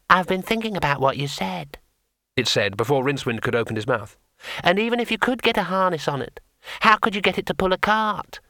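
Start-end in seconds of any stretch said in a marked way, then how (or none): 0.1-1.8 a small painted imp, unhurried; tiny imp. Acted. Imp settling the matter for the wizard, small creature voice. 4.6-8.4 a small painted imp, unhurried; tiny imp. Acted. Imp settling the matter for the wizard, small creature voice.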